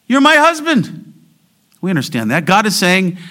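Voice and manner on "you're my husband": high, loud impassioned claim; insistent, raised-voice declaration; jealous spouse. Acted. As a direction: cry it out as a possessive spouse.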